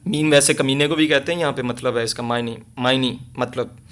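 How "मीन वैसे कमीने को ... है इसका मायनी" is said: normal talking voice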